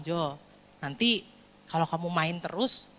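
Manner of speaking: soft, low parental counsel; gentle advice. Acted. Parent advising a playful son, gentle. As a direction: reason with the child quietly, low.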